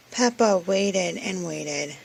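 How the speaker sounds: ordinary delivery, nothing marked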